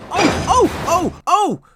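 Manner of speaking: high, loud squealing trucks; screaming trucks. A narrator's reading. Silly trucks bumping into each other, screaming in alarm. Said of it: "they bump into each other screaming".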